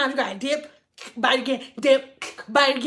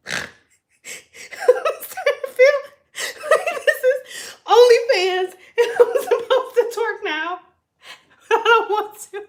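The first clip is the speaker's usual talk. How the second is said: much higher, slow, laughing almost to tears; uncontrollable laughing; 8 semitones higher, slower than usual. Real, not acted. Embarrassed creator jokes to donating viewers, laughing helplessly, high and slow. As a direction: lose it laughing while talking. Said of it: "I laugh uncontrollably".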